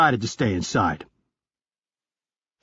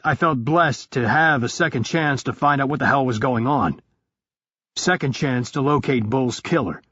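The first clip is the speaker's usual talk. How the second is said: ordinary delivery, nothing marked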